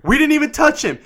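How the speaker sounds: acted-out indignant comeback; mock angry retort. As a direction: argue back, rising to a loud protest. Dad argues back at angry biker.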